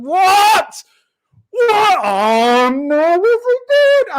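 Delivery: very high, loud cry of disbelief; high, loud stunned yelp; real shocked shout. Real, not acted. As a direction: scream in dismay at the goal. Reacting to conceding.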